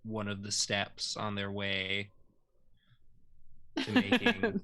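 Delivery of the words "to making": silly high voice. Pretend.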